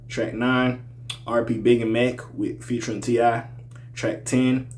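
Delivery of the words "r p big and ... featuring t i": usual speaking voice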